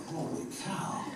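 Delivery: high, breathy awe; amazed. Retold or imitated. Recalling first meeting his wife, awestruck. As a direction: gasp it out in wonder.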